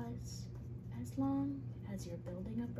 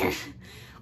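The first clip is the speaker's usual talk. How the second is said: troll laugh; 6 dB louder than usual. Acted.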